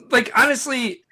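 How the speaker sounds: ordinary talk, nothing marked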